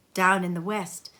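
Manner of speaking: usual speaking voice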